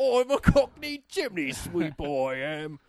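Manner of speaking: high hammy Cockney chimney sweep; overdone Cockney lilt, loud start; Cockney accent. Acted. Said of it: "impressed that you even attempted one".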